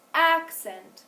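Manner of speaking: "Accent" with the first syllable held longer, high and loud; long stressed first syllable. Shown as a demonstration. Teacher tests pupils on length, long stressed start to "Accent". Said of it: "if I say it longer or shorter".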